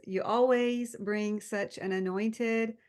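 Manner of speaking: normal talking voice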